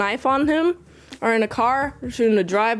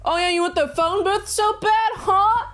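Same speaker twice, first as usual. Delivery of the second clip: high-pitched goading challenge; mock taunt; 7 semitones higher than usual. Gunman to the man wanting the booth, mock taunt. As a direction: goad him with a rising, taunting question.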